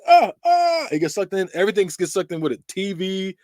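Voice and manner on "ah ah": high, loud panicked cry as he's pulled under; startled yelp. Retold or imitated. Johnny Depp being sucked into his bed, high startled yelp. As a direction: yelp as the bed swallows you. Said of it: "He's like".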